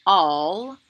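all said loud and clear; sight word modelled. Shown as a demonstration. Teacher modelling sight word for children. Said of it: "Can you say all?".

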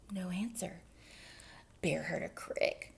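ordinary delivery, nothing marked

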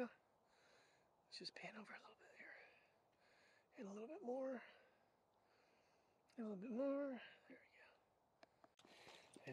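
hushed whisper while filming; soft unvoiced narration over the shot. Real, not acted. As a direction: breathe the words so the mic barely catches them. Quietly filming the view.

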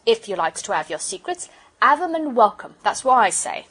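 aggrieved working-man voice, forcefully delivered; huffy porter. Acted. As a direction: wave them off as an offended porter.

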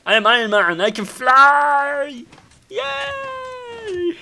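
loud boast climbing to a squeal; playful Iron Man cry. Pretend. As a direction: shout it like a kid pretending to fly. Plays flying Iron Man in glee.

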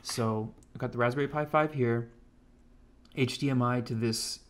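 normal talking voice

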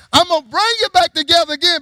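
high, loud preaching shout; proclaimed promise. Acted. Same prophecy preached out loud.

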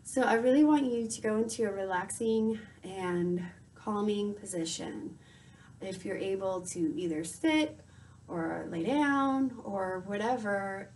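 normal talking voice

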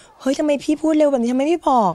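high-pitched mock dismay; dismayed self-reproach. Retold complaint on watching her video. (โวยวายตกใจแบบเล่าเลียน เสียงสูง)

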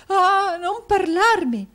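high, slow, wailing sob through the words; a wife sobbing at her ruined husband. A narrator's reading. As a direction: cry it out, pushing him away. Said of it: "rispose la moglie singhiozzando".

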